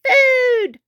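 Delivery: high, drawn-out cries for food; high mock-thundering shout. A narrator's reading. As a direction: demand food like a greedy little dinosaur. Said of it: "thundered Tiny".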